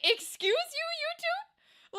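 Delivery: very high, squeaky offended voice dissolving into a laugh; high-pitched mock outrage with laughter; mock indignation. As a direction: play it scandalized then crack up. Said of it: "I'm like".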